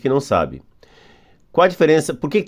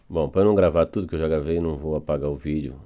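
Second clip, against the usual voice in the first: normal talking voice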